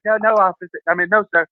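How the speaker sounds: high, hurried polite backpedal; meek reply. Retold or imitated. To the cop who seemed like his dad, meek high apology. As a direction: sound like a kid answering his dad.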